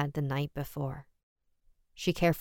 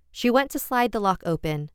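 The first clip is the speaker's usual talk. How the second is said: usual speaking voice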